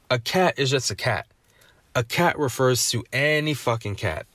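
normal talking voice

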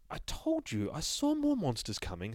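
high, strained narrator voice; narrator character. Acted. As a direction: pitch it up as the nervous narrator. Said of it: "says the narrator".

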